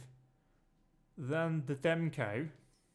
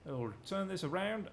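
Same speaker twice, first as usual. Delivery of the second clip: ordinary talk, nothing marked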